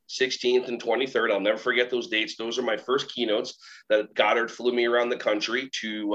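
ordinary delivery

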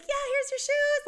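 put-on girl at the door, super sweet and bright. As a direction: imitate the sweet young girl handing over shoes. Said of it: "Like, super sweet".

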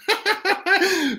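high giggling peal; real laugh. Real, not acted. Poet breaking off the recital, real laugh.

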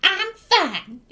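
a hint of a high goblin voice; goblin Yzma. Goblin answers whether she is all right, lightly voiced goblin. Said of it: "and she's like".

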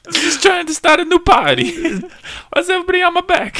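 very high, loud comic whine; Hitler. Acted. Comic giving Hitler's perspective, squealed mock grievance. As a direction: complain in a squeaky mock-aggrieved voice. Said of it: "tell it from his perspective".

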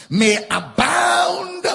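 a high, slow, loud cry on "may abound"; preached shout. Real, not acted. Preacher reading scripture with force.

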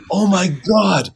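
high, loud, drawn-out mock amazement; hammed-up shock, slow and high; astonished recognition. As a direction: imitate a stunned fan recognizing someone. Acts neighbor suddenly recognizing the actor.

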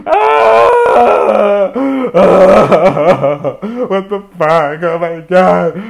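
loud, high hammed-up wail; crying. Reenacting himself breaking down in the shower, loud mock crying. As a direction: bawl in exaggerated despair. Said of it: "I am crying in the shower".

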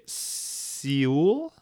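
Seoul drawn out as "see-OOL"; completely wrong pronunciation. Pretend. Pranking people back home, Seoul mispronounced as a slow "see-ool". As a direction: slowly stretch Seoul into "seee-ool". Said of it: "pronounce it completely wrong".

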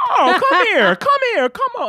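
high, laughing excitement; excited laughing. Real, not acted. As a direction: squeal it with laughter.

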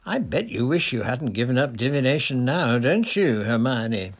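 loud, gloating taunt with swooping pitch; smirking. A narrator's reading. Parvati taunts Hermione for giving up divination, smirking. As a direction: needle her through a smirk.

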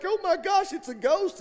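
high-pitched mock panic; hammed-up fright; scared. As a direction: blurt it as the frightened crowd, voice shot up. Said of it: "and everybody's scared".

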